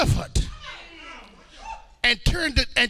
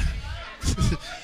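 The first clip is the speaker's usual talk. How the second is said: short, soft breathy chuckle; quiet laugh after a sly remark; 6 dB quieter than usual. Real, not acted. Preacher just threw that out there, soft chuckle.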